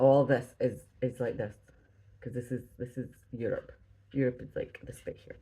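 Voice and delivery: ordinary talk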